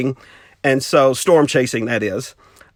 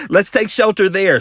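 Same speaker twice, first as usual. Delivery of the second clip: higher, laughing mock scolding; teasing friend; 9 semitones higher, 3 dB louder, slower than usual. Friend laughing at Mark in the car, teasing scold. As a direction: tease him with a laugh while bossing. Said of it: "she's like".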